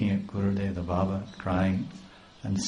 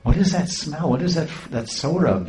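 amazed wonder; 7 semitones higher than usual. Retold or imitated. Devotees amazed by strange fragrance.

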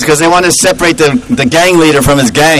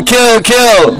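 usual speaking voice

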